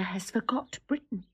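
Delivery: breathy whispered aside, pitched low. Acted. Wife whispers her fear about her lord. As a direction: let it slip out under the breath.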